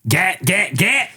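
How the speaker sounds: high cartoonish hype voice; put-on character voice. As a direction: squeak each word in a silly voice. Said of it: "I just love doing the voice".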